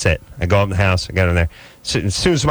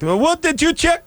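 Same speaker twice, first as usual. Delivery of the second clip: much higher put-on voice of a pestering neighbor lady; neighbor woman calling. Neighbor lady summoning Adam to look, in a much higher put-on voice. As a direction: squeal for Adam like a nosy neighbor.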